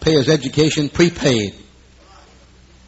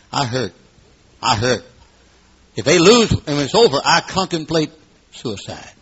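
ordinary talk

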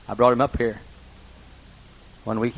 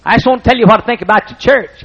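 much higher and louder, insistent; loud, insistent old farmer; Wood repeating emphatically; 11 semitones higher, 10 dB louder than usual. Retold or imitated. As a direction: press the point with full feeling. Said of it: "he talked like this".

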